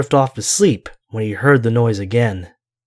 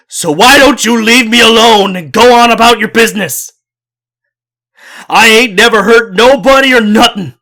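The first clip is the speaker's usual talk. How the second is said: high, loud desperate yell; a terrified old man yelling at the creature; yelling as loud as he can; 9 semitones higher, 7 dB louder than usual. Acted. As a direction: scream at it to go away. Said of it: "yelled back as loud as he could".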